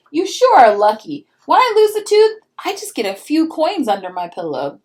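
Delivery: put-on a kid brother complaining it's unfair; envious little brother. Peter envies his sister's gifts, loud whiny kid voice.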